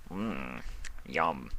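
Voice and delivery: soft low lip-smacking mmm; satisfied yum. Acted. Discord finishing the cake, soft and contented. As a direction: savour the last bite quietly.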